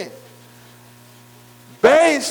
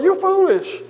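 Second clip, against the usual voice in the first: "You foolish" pitched up high in scorn, "You" lifted; escalated jeer, "You" hit high; mocking scorn, bigger; 9 semitones higher than usual. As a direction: scoff "You foolish" from way up high, harder this time. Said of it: "he tried to get them to say".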